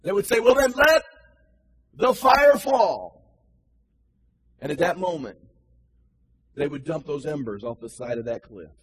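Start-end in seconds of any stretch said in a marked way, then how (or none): 0.4-3.2 loud, higher, slow drawn-out cry. Retold or imitated. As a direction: stretch the cry out over the valley. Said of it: "this lone voice that would cry out".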